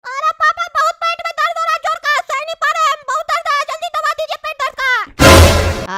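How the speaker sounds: put-on high, wailing child voice; squeaky kid pleading through pain; little boy in agony. Child in pain begs for medicine.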